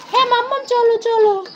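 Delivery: put-on high, eager kid voice; bursting to show mum something; excited child. Child urging mummy to come see, high and excited.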